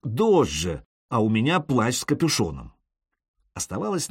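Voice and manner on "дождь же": usual speaking voice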